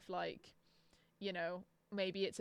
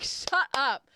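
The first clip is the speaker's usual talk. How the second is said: loud, slow, punched "shut up"; emphatic higher-pitched scolding; exasperated outburst; 5 semitones higher, 12 dB louder than usual. Real, not acted. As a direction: raise pitch and volume, hit each word. Repeats the scolding harder.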